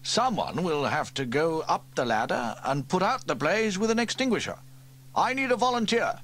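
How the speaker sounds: rally the men fast; urgent chief. Acted. Fire chief orders his men out, urgently. Steele asks volunteers.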